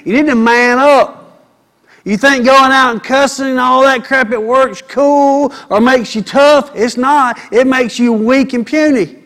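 loud, high preaching, hammering each point; full-force sermon voice; fiery preaching. Acted. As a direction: preach it hard at the men.

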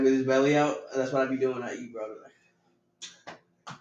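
ordinary delivery

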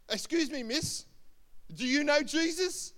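high, loud mock-earnest question; mocking shopkeeper. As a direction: call across the shop to tease. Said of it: "he's a real mocking kind of guy".

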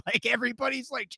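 ordinary talk, nothing marked

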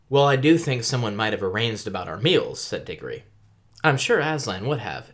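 reading aloud. Acted. Reads book passage aloud.

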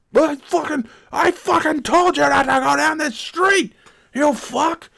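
hammed-up squealing rant; shrieking high tantrum. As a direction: shriek it like a petulant coworker. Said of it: "He's like".

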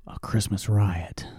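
whispered, slow, relishing "a Christmas riot"; slow mischievous whisper; hushed whisper. Real, not acted. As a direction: lean in and whisper it like juicy gossip. Gleeful hushed aside.

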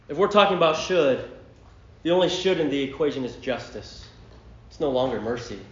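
normal talking voice

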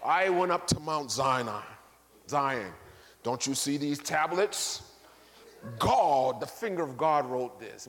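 lightly voiced self-important bishop; hammed-up claim of divine authority; pompous bishop. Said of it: "pull the bishop card".

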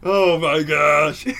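drawn-out laughing oh my gosh. Real, not acted. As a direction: laugh through it, high. Laughing exasperation.

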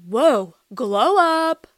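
drawn-out, loud mock awe; exaggerated "whoa", stretched out; mock amazed commenter. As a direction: imitate gushing commenters, very slowly. Mimicking commenters from middle school.

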